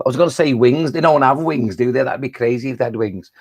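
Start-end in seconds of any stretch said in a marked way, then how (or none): none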